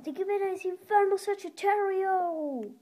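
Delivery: loud winner call; excited announcement. Real, not acted.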